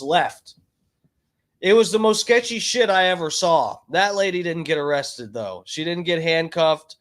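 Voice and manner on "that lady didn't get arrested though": ordinary delivery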